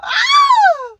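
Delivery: sudden high anguished cry; frustrated scream. Real, not acted. Venting despair to viewers, a sudden scream. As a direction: let out a sharp, very high wail.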